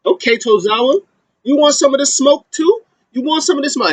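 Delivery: high-pitched imitation of a wrestler's challenge; mock challenge. Wrestler turning on Tozawa who stopped him, mock confrontational. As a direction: throw out a high, taunting dare. Acted threat from Chad Gable.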